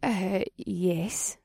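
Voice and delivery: soft, unsure halting reply; hesitant. A narrator's reading. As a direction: answer hesitantly, caught off guard. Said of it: "in a hesitant voice".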